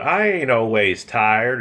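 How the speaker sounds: [name] imitating Hillary's fake Southern accent; mock Southern accent. [name]'s Hillary impression, mock Southern accent. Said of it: "she would use accents".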